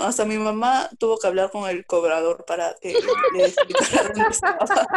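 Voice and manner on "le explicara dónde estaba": words breaking into high laughter; giggling as she tells friends; laughing. Real, not acted. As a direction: laugh through the end of the story. Laughing at own story.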